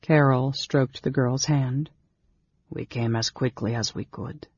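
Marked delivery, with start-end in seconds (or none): 2.7-4.5 low, soft reassurance; gentle. A narrator's reading. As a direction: answer gently, lowered and hushed. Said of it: "his companion said gently".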